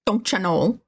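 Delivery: mock Minnesotan "Don't you know?"; drawn Minnesota vowels on know; Minnesota accent. Streamer imitating Fargo's Minnesotans in a Minnesota accent. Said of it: "do people actually talk like that".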